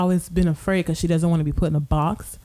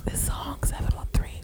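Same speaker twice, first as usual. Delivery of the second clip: breathy ASMR whisper, unvoiced; 6 dB quieter than usual. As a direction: whisper close to the mic like an ASMR video. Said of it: "A S M R review".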